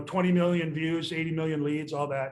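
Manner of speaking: normal talking voice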